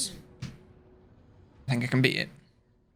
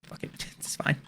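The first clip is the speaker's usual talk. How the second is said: much softer, breathy whispered aside; real whisper; 11 dB quieter, slower than usual. Real, not acted. Streamer shrugging off a slip, in a whisper. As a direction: drop to a whisper, almost to yourself.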